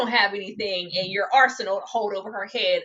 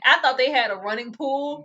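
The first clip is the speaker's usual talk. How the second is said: ordinary delivery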